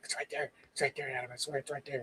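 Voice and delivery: quietly insist, as if shaken. Retold or imitated. Brother at the pool ladder pointing it out, hushed and shaken. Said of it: "he's, like, a little shaking".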